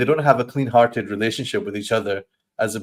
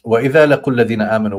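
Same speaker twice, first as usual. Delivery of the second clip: Quran recitation; 7 dB louder, much faster than usual. Acted. Recites Quran verse in Arabic.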